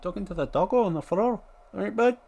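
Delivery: high, loud squeaky mock onlooker. Passerby asking why he talks to the dog, high and loud. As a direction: pitch way up as a baffled passerby. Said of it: "What's with the Scottish accent?".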